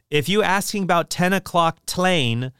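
slow, mock Chinese-accented stationmaster read from the book. Stationmaster answers the president's call, in a mock Chinese accent. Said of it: "it's written like that in the text".

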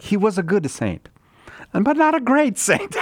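high mock Italian priest voice, rising on "not"; high put-on Italian priest voice; Father Guido Sarducci. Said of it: "says about Saint Patrick".

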